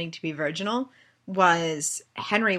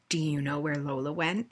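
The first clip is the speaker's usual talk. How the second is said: stern dad; 4 semitones lower, 7 dB quieter than usual. Retold or imitated. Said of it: "like, the angry dad".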